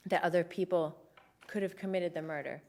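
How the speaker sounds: faint and lightheaded, trailing off. Real, not acted. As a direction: speak like you're about to faint. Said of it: "I'm feeling very lightheaded".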